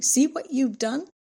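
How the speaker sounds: exasperated rant while leaving the room; shouting. A narrator's reading.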